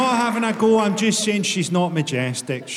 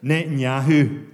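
Scottish accent; 4 semitones lower than usual. Shown as a demonstration. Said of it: "sound better in a Scottish accent".

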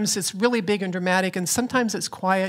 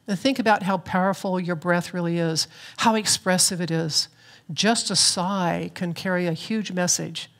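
ordinary talk

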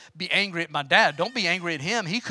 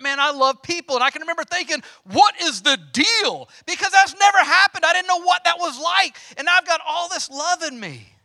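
high, loud burst of wonder; the Grinch's heart growing, all amazed; joyful awakening; 8 semitones higher, 4 dB louder than usual. Retold or imitated. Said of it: "Grinch in the snow moment".

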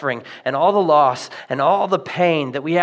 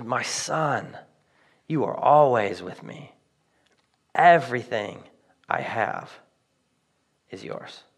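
soft, low and gentle, fading to a whisper; a father reassuring his hurt son softly; tender father; 5 semitones lower, 3 dB quieter than usual. Acted. Father answering his angry older son, tender and hushed. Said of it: "He says".

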